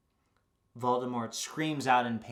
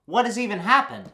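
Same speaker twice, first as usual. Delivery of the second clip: high, loud, frantic question; panicked disbelief; 11 semitones higher, 11 dB louder than usual. Retold or imitated. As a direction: yelp it in alarmed disbelief. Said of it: "And I'm like".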